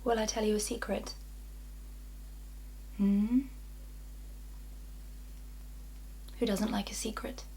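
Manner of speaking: soft, hushed tease of a secret; confiding. Acted. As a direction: lean in and confide softly, drawing the listener in.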